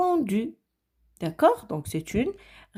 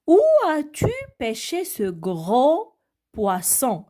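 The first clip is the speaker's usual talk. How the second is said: slow, higher question read to the class; reading an example aloud; 4 semitones higher than usual. Shown as a demonstration. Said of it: "je vais vous montrer quelques exemples".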